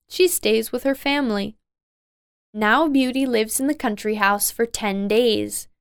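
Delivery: ordinary delivery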